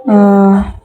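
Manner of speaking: normal talking voice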